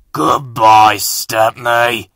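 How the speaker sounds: gloating diesels. Acted.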